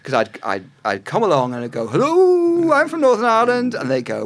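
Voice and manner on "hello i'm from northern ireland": high, loud sing-song hello, held long; eager newcomer's greeting. Retold or imitated. Writer introducing herself to drama bosses, mock-eager high call. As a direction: imitate yourself piping up far too keenly. Acting out her cheery pitch to executives.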